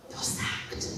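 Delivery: hushed, breathy whisper of the verdict; whispered firing. Retold or imitated. CTO firing her in the kitchen, whispered. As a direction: let the last words drop to a whisper. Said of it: "He didn't whisper that last bit".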